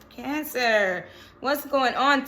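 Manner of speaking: ordinary delivery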